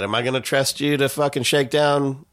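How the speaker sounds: normal talking voice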